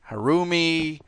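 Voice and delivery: "Harumi" ending in a "mee" sound; mispronunciation. Retold or imitated. Quoting how people get her name wrong, "Harumi" ending "mee". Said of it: "people have pronounced it".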